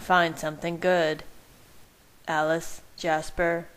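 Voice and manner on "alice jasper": ordinary delivery, nothing marked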